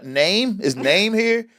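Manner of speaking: puzzled teacher calling attendance; roll-call teacher. Retold or imitated. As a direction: read it off the list, unsure, voice lifted. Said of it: "roll call in class".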